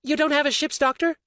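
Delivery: high, incredulous woman's voice; can't believe it. A narrator's reading. Orly questioning Roberto, incredulous woman's voice.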